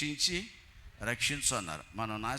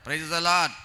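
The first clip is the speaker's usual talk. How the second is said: loud praise the Lord; 4 semitones higher, 9 dB louder, much slower than usual. Retold or imitated. Congregation exclamation, retold.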